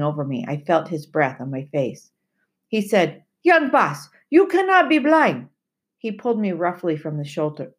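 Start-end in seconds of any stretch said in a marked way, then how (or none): 3.4-5.5 put-on high, loud, urgent old sailor; Timothy pleading. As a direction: insist in disbelief as the sailor. Timothy's voice in the story.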